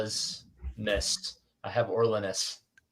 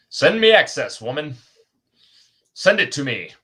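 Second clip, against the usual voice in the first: mock demand; 2 semitones higher, 7 dB louder than usual. Mock order to writer for access.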